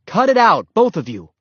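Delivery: loud command, starting high then dropping; shouted order. A narrator's reading. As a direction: order the fighting dogs to stop. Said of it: "ordered Henry".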